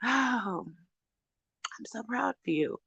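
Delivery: soft, slow, hushed pride; tender praise. Real, not acted. Vlogger softly praising a subscriber's sober days, tender. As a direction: gently tell her you are proud.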